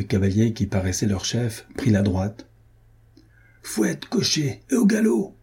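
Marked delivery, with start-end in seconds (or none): 3.6-5.4 high, drawn-out calling order; shouted command. A narrator's reading. Chief horseman urging the coachman to gallop, high calling command. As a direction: call it out slowly, rising higher at the end. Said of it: "cria-t-il".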